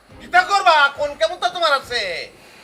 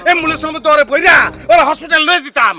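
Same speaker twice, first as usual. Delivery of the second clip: shouted, louder, raging; angry shout; 6 dB louder than usual. Acted. Son vows to put father in hospital, shouting. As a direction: yell it out in fury.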